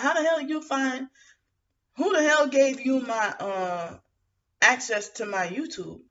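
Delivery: pitched high, retelling her outrage; mock-furious, demanding who gave him access; indignant retold outburst. Retold angry confrontation.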